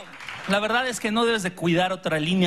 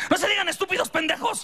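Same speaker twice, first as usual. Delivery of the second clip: mom scolding; 12 semitones higher than usual. Retold or imitated. Mom breaking up the fight.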